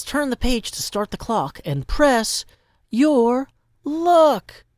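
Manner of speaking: reading the sign in a big announcer voice, rising loud at the end; booming game-show read of the sign; dramatic reading. Acted. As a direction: press your luck swelling to a loud high call. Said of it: "where it says".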